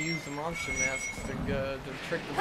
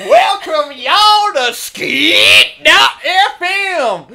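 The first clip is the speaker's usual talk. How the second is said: loud, drawn-out country DJ shouting; 14 semitones higher, 18 dB louder, slower than usual. Acted. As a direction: hype it like a backwoods radio ad. Hick radio ad parody.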